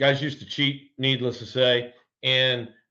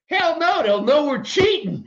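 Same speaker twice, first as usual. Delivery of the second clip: retold yell; 13 semitones higher, 5 dB louder than usual. Yelling back to the pitcher.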